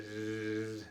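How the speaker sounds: soft buzzing zzz like a bee; soft imitated bee buzz. Shown as a demonstration. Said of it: "que hace la abeja".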